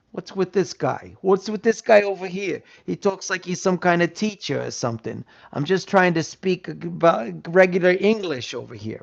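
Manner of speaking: mock a direct New York City guy; New York attitude. Mimicking a New Yorker mocking a teacher-like speaker. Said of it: "if you talked like I'm talking now to a person from New York City".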